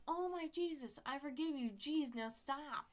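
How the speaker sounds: loud, high, fed-up outburst; acted-out exasperation. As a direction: beg them to stop, loudly and dragging the words. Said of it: "I was annoyed. I was frustrated".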